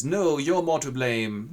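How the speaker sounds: slow, loud insistent reply; slow insistence. Acted. Wurm slowly throws the blame back. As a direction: drag the blame back at him.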